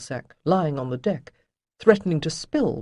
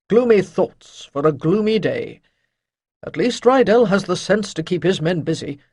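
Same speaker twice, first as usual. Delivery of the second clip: louder, lightly voiced old master; old sage Chayden; 3 semitones higher, 6 dB louder than usual. A narrator's reading. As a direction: shake off gloom with gruff cheer.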